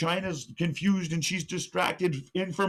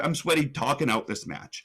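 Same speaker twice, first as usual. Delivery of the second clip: ordinary talk, nothing marked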